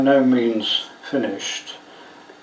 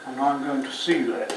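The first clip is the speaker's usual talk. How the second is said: holding breath; 6 dB quieter than usual. Real, not acted. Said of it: "now I'm holding my breath".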